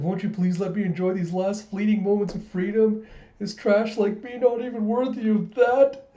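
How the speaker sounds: high, loud hammed-up begging; melodramatic pleading. [name] begs to enjoy his last free moments, melodramatic.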